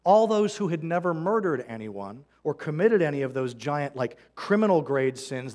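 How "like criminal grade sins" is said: ordinary delivery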